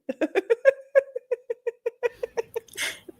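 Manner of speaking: high snorting wheeze laugh; helpless wheezy cackle; wheezing laugh. Real, not acted. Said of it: "snorting and wheezing".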